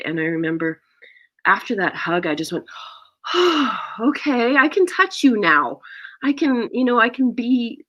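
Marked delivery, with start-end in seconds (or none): 3.2-3.9 big, high exhale; acted sigh of relief; relieved sigh. Acts relief after the hug. 4.0-7.8 high, freed-up delight; acted happy relief after nerves; relieved. Acts relief after the hug.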